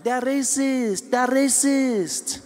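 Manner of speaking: slow, high drawn-out mock outrage; mock outraged accusers. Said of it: "people were like".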